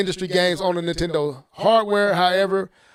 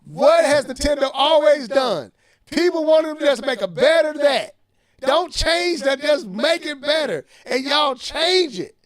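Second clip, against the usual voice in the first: higher and worked up, building to a shout; heated rant; 5 semitones higher, 3 dB louder than usual. Real, not acted. Host ranting at Nintendo's critics, heated. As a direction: vent it, getting louder as you go. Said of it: "Let me calm down".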